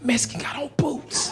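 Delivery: urgent stage whisper; hushed secret warning. Retold or imitated. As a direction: whisper the code phrase. Passing the secret warning again.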